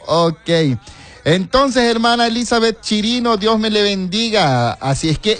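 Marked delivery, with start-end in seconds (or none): 1.2-4.7 saludo agudo con acento de Santa Cruz de Guayape. Shown as a demonstration. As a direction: exagera el cantadito hondureño de Santa Cruz. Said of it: "yo le hago así porque así hablamos allá". (acento cantado de Santa Cruz)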